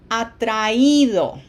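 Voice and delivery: atraído with stress on the í, a-tra-Í-do; clear Spanish word. Shown as a demonstration. Teacher demonstrates atraído for the class, slowly. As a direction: say atraído slowly, four syllables. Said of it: "put an accent on that I".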